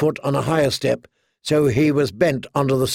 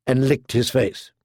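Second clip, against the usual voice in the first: ordinary delivery, nothing marked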